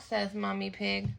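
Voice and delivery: plain reading voice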